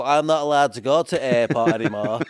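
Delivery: hammed-up English cabbie accent; mock English accent. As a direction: mimic an officious English taxi driver. Same mock voice for Uber driver.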